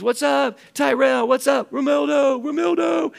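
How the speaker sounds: high, loud friendly call across the room; yelling names. Retold or imitated. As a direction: call out their names like greeting old friends. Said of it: "I'm just yelling names".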